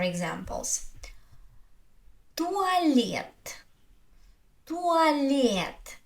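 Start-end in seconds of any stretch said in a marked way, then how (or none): 2.3-6.0 "туалет" with a long, high open e in лет; stressed е as э. Shown as a demonstration. Teacher giving an example, "туалет" with long stressed open e. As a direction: lean on the last syllable of "туалет". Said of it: "Я is stressed, so I say".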